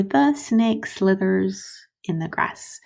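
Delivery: slow, clear model sentence; example sentence read slowly. Shown as a demonstration.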